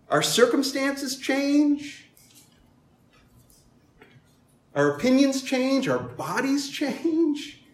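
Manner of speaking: high, loud, slow preaching cadence; rising sermon refrain; preaching at full force. Acted. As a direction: drive each line home from the pulpit.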